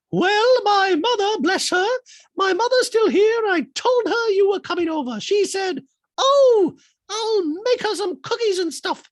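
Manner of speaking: high, loud squeaky voice; shrill robotic Dorit impression.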